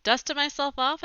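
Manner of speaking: ordinary delivery, nothing marked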